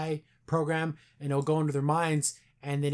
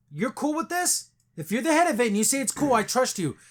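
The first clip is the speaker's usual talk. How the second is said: high, incredulous whoa; startled disbelief, pitched way up; surprised; 8 semitones higher, 3 dB louder than usual. Retold or imitated. Guy questioning the boss, high and incredulous.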